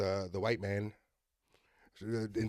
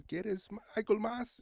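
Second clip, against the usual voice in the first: high, soft, hesitant waiter; lightly voiced waiter; 10 semitones higher, 6 dB quieter than usual. Comic voicing a waiter at a restaurant, high soft mock waiter. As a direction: lightly imitate a waiter, pitched up in halting Spanish. Acting out waiter approached in Spanish.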